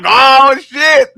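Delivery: loud mock shock breaking into a cry. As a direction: blurt it out loud, horrified. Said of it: "you looking at her like".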